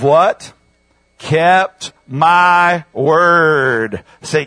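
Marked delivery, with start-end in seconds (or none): 1.2-4.0 very slow, drawn-out words; slow emphatic quote. Real, not acted. Preacher giving the congregation a scripture phrase, slow and emphatic. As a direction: stretch out each word deliberately.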